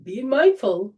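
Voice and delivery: words squeezed out while holding breath. Real, not acted. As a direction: speak with lungs held full. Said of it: "hold my breath".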